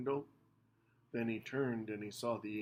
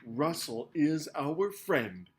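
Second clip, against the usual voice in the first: loud; 4 semitones higher, 8 dB louder, slower than usual. A narrator's reading. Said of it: "Minivan said loudly".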